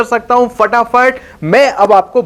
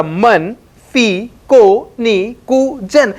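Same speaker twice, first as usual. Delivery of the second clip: "मन फी को नी कु जन" spaced out very slowly, each syllable apart; much slower than usual. Shown as a demonstration. Teacher slowly breaks the phrase apart for students. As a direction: spell out the mnemonic slowly. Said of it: "उसने क्या बोला?".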